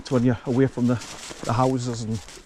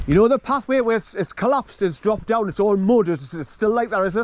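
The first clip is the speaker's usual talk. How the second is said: raised voice across distance; 8 semitones higher than usual. Real, not acted. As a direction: make sure the far-off walker hears the question. Asking a walker across distance.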